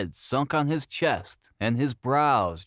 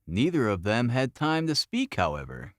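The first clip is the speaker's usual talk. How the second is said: plain reading voice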